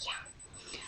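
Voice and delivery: whispered inner yeah. Retold or imitated. Saying yeah in her head to the hostess, whispered. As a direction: breathe a private, sarcastic yeah. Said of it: "In my head, I was like".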